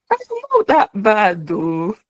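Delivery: high, self-pleased mock boast; chuffed with yourself; pleasantly surprised. Pleased with own recording.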